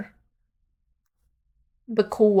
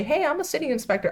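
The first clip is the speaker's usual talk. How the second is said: lightly voiced, higher-pitched inspector; quick, high put-on hello; mock city inspector; 7 semitones higher, 3 dB quieter, faster than usual. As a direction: imitate a chirpy doorstep greeting. Imagined inspector at her door.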